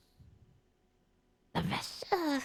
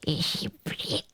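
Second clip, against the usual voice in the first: much lower, slow eerie whisper; creepy whisper; 8 semitones lower, slower than usual. Acted. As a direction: hiss it from the dark corner. Creepy hobo creature speaks.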